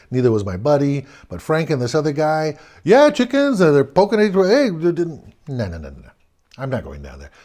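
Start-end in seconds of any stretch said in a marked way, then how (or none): 2.8-5.3 high, loud jeering at the chickens; mocking taunt. Friends taunting scared kids. 5.5-7.3 nervous no, low and hushed; scared refusal. Retold or imitated. Narrator refuses to enter basement.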